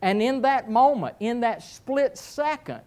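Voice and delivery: ordinary delivery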